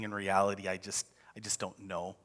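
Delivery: very soft, low admission; acted humility, trailing down to almost nothing; humbled. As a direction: drop the voice and confess it humbly. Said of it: "humbling ourselves before God".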